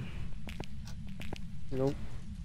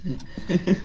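ordinary delivery

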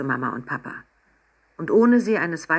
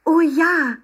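high, loud, gleeful exclamation; delighted cry; 12 semitones higher, 7 dB louder than usual. A narrator's reading. Emma accepts eagerly and claps, delighted cry. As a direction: burst out with childlike delight.

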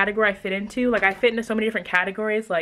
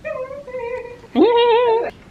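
high laugh; 5 dB louder than usual. Real, not acted. Laughs at her own channel.

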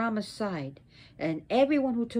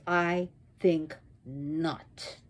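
slow, low, measured refusal; cold refusal; 7 semitones lower, 3 dB quieter, much slower than usual. Acted. Sarasvati refuses her husband, coldly and slowly. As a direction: draw out each word of the refusal. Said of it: "in Sarasvati's eyes".

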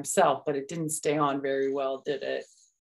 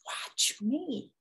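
soft high proud; 9 semitones higher, 6 dB quieter than usual. Acted. Showing baby.

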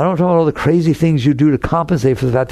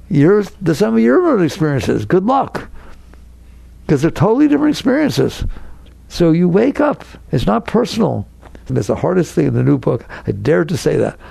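normal talking voice

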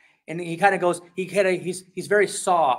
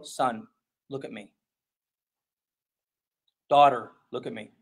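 low, firm call to a distracted child; a parent pulling a child's attention back; stern parent voice; 6 semitones lower than usual. Parent calling a child who won't look.

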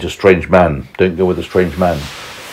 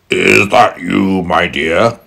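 lightly croaked, drawn-out granny impersonation; faint croak; slower than usual. A narrator's reading. Wolf posing as Granny in bed, slow faint croak. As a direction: stretch it out like a feigned old granny. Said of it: "croaked a faint voice".

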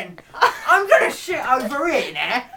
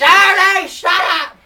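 sudden loud shout to shut her up; yelling; 6 semitones higher, 9 dB louder than usual. Acted. Sister yelling at Julie to shut up, loud shout. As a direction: bark it across the room at full volume. Sister yells across the room.

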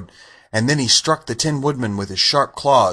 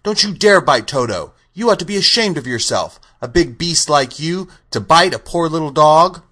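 put-on high, louder scolding girl; indignant child telling someone off; indignant cry; 7 semitones higher, 4 dB louder than usual. A narrator's reading. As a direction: scold a big bully in a raised voice. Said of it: "while she cried out".

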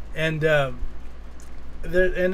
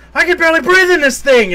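much higher and louder wheezy-voiced villain breaking character; Dark Helmet; 11 semitones higher, 11 dB louder than usual. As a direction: squeak it out like a villain who can't breathe in his helmet. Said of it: "So he puts the helmet up and he goes".

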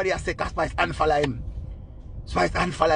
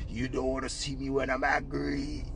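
much softer, slow, drawn out; mock menacing hush; 9 dB quieter than usual. Vlogger mimicking [name]'s hushed warning. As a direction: hush it like a quiet threat. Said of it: "quoting [name]'s threat".

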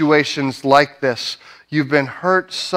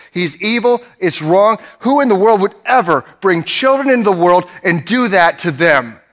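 retold explosion of anger, pitched well up; angry outburst; 5 semitones higher than usual. Exploding to his supervisor about a woman's abusive father, angry outburst. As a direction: let the pent-up anger burst out, retelling it. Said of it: "I remember just exploding and just saying".

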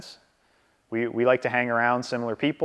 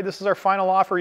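put-on older male agent bullying, pitched higher; mock the pushy veteran agent; assertive bully; 7 semitones higher than usual. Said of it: "there's this assertive bully kind of tactic".

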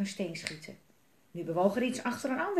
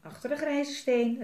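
higher-pitched warning call; raised, urgent story voice; 6 semitones higher, 4 dB louder than usual. A narrator's reading. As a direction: call it out to your companions. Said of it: "riep Melle".

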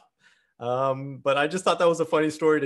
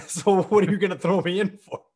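laughing through the question, louder; retold laughing comeback; laughing retort; 6 semitones higher, 6 dB louder than usual. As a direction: scoff at the cops with a laugh. Mocking cops while laughing.

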